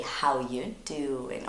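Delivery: slow, low drawled catchphrase; Joey from Friends. Retold or imitated. Teacher quoting a Friends catchphrase, lightly imitated drawl. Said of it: "Where Joey used to say".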